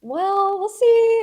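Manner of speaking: high, drawn-out imitation of a noncommittal analyst; mock her with a high-pitched "we'll see"; doubtful sing-song okay. Retelling the analyst's dubious answer.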